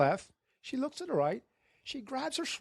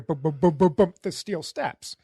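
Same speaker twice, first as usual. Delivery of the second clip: slow, deliberate booming footsteps on the stairs; lightly mimicked clanging footsteps on steel steps; heavy footsteps; 4 semitones lower than usual. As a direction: stomp out each boom like heavy boots.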